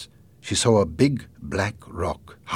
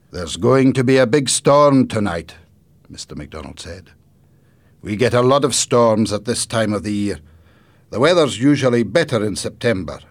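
louder, higher grandfather voice; grandfather character; 4 semitones higher, 9 dB louder than usual. Acted. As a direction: project as an animated storybook grandfather. Grandfather warns of the storm.

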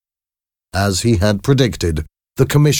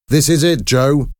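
high-pitched, restrained narrated shout; shouted encouragement; 7 semitones higher than usual. A narrator's reading. Trainer to boxer over the noisy crowd before the fight, shouted. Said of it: "he shouted over the noisy crowd".

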